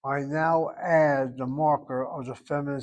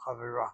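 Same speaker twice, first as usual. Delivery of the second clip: chavira stressed on the last syllable, softer; final stress, softly; 4 semitones lower, 7 dB quieter, faster than usual. Shown as a demonstration. Teacher repeats chavira with final stress. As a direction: repeat chavira with the accent at the end. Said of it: "the accent is on the last syllable".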